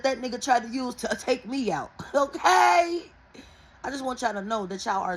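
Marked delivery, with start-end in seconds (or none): 2.0-3.0 loud high laugh into okay. Real, not acted. Telling viewers the dream, she laughs loudly. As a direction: burst out laughing, then okay. Laughs at her own story.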